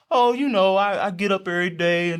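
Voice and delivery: generic polite excuse. Acted. Believer giving a generic answer to a coworker, breezy and evasive. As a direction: dodge it with airy small talk. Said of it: "can't try to just be generic".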